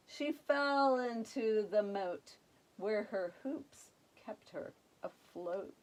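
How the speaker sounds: usual speaking voice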